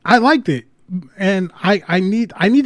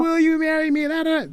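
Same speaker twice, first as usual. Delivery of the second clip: mock proposal; 13 semitones higher, 3 dB quieter than usual. Retelling his proposal to stream chat, soft high mock proposal. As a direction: pop the question in a hushed high voice. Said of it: "I was like".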